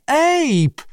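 very high plaintive call; moaning. A narrator's reading. Abandoned Lee calls Abe back, moaning. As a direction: moan the name like an abandoned kitten. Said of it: "jęczał opuszczony koteczek".

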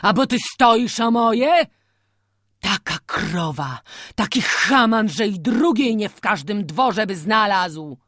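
loud grieving rant over a lost cow; angry lament. A narrator's reading. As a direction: berate them while grieving the cow. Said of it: "wyrzekał coraz żałośniej".

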